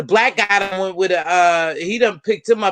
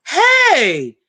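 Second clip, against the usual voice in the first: calling out; 7 semitones higher, 4 dB louder than usual. Real, not acted. Calling out to her nephew.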